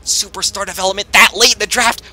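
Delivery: normal talking voice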